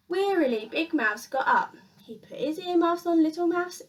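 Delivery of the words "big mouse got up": ordinary delivery, nothing marked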